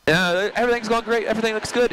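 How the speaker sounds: breezy, high, carefree voice; blissfully unaware, everything's fine; carefree and complacent. Retold or imitated. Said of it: "fat, dumb, and happy".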